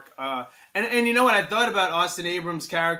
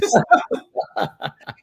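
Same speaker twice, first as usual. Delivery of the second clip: high laughing burst; burst of laughter; 3 semitones higher, 5 dB louder than usual. Real, not acted. Laughing at his own joke about the kid. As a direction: let a loud laugh break out.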